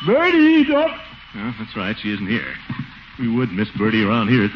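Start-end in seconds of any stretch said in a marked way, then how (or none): 0.1-0.9 sudden high, loud call; forgetful call for the missing cook. Acted. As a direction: shout her name before remembering she's out. Calls the absent cook.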